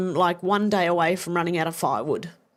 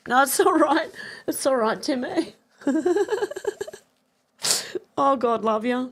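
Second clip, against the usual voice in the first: real happy tears, voice pitched high; crying; 7 semitones higher than usual. Real, not acted. Streamer thanking Timmy for the donations, crying. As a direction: overcome with gratitude, high tearful voice. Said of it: "That's why I'm crying".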